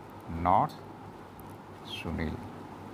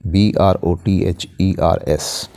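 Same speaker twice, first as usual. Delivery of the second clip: spelling out letters; 3 semitones lower, 5 dB quieter, much slower than usual. Shown as a demonstration. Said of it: "my brothers".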